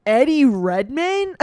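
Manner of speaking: stunned surprise; shocked. Real, not acted. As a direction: blurt out the name in disbelief. Shocked by quiz result.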